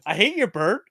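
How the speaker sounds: high, emphatic retold protest; mock indignant denial. Narrator denies killing his friend's bird, mock indignant denial.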